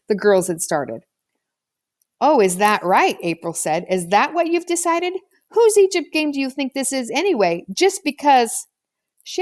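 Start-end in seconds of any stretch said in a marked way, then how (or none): 2.2-8.7 put-on higher, pointed retort of an offended girl; a girl snapping back, miffed; indignant retort. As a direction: bristle at being bossed around. April indignant at Toby.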